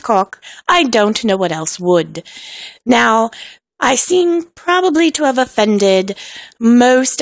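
hammed-up mock-British voice; horrific British accent. Host apologizing to UK listeners, bad British accent. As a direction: keep up a badly faked British accent. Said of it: "continue in this horrific British accent".

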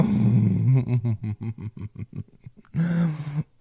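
a loud, breathy laugh full of delight; loud breathless laugh. Acted. Lover laughing with delight during sex, loud and breathless.

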